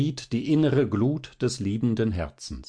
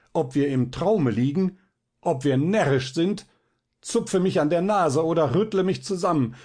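desperate pleading; 6 semitones higher than usual. A narrator's reading. Balthasar begging Fabian to wake him, frantic and rising. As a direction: build from a plea to near panic.

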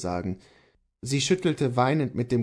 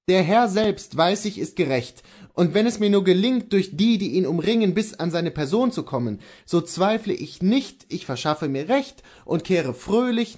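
confident, higher-pitched husband; Kohlhaas the husband; 7 semitones higher, 3 dB louder than usual. Acted. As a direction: reassure your wife with conviction.

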